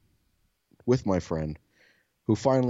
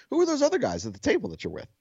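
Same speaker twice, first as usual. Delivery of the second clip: put-on high, fast nosy child; kid voice; 13 semitones higher, faster than usual. Kid quizzing the stranger about his table, quick and high. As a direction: rush the question like a curious kid.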